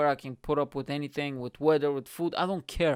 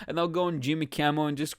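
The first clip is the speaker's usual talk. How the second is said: usual speaking voice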